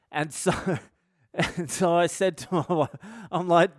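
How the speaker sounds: ordinary talk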